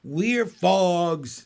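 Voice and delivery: loud little frog yell; froglets screaming. A narrator's reading. Froglets announce themselves to parents, loud screaming. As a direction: belt it out as a chorus of young frogs. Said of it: "screamed the froglets".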